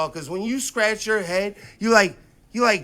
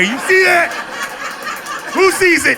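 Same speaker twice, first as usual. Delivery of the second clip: high, loud demanding shout; mock-stern fatherly yelling; threatening dad; 10 semitones higher, 5 dB louder than usual. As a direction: bark it as a warning to your kid. Acted threat to his son.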